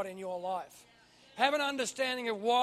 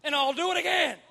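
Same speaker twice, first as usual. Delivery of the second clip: loud, high booming proclamation; preacher voicing a triumphant heavenly answer; calling down; 7 semitones higher, 7 dB louder than usual. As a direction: call it out from on high. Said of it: "the Father calls down from heaven".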